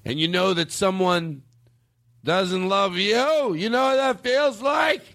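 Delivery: put-on high, slow drunken shouting lament; drunk angry wail. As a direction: bellow it like a heartbroken drunk. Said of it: "everybody knew he was an alcoholic".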